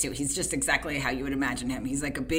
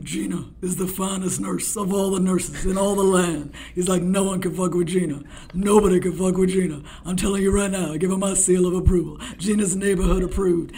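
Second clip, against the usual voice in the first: imitating Shaq; 2 semitones higher, 4 dB louder than usual. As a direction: bless the nurse like a royal decree.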